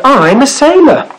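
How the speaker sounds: high, eager little sailor voice; high rabbit voice. Acted. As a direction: squeak it out like a small rabbit. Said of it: "said the creature".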